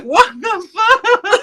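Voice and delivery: high, laughing what-the-fuck; disbelieving laughing squeal; shocked disbelief. Retold or imitated. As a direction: squeal it in stunned glee. Stunned reaction quoted.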